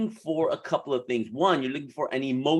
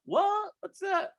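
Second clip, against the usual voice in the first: much higher, puzzled what?; mock surprise; 3 dB quieter, slower than usual. Coach acts a client hearing a new word, high mock surprise. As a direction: play the baffled client, high.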